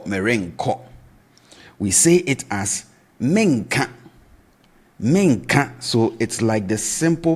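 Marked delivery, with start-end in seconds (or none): 1.8-5.8 menka with the tone raised at the start. Shown as a demonstration. Teacher showing learners the future negative, high start. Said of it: "we raise the tone at the start of it".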